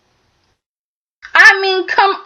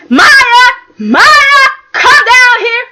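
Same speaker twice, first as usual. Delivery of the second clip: higher and louder, calling up the stairs; 8 semitones higher, 6 dB louder, slower than usual. Retold or imitated. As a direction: yell for Maya to come down. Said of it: "Rick was upset".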